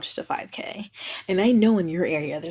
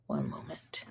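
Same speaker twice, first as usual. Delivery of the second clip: whisper; 14 dB quieter than usual. Real, not acted. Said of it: "I'm just trying to post this".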